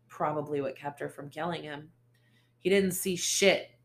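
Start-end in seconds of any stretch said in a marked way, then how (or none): 2.6-3.7 loud barked dismissal; barked retort. Acted. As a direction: bark it at her. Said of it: "Ace barked".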